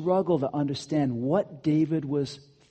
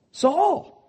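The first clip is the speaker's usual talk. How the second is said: ordinary delivery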